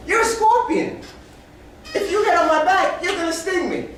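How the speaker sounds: very high-pitched, disbelieving frog; high comic voice of alarmed refusal; incredulous frog. Acted. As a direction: protest shrilly as a frog who isn't fooled. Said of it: "Frog says".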